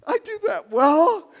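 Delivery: loud, slow, near tearful cry of joy; overjoyed. Retold or imitated. Word-lover receiving praise, loud tearful joy. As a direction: burst out as if praise just landed.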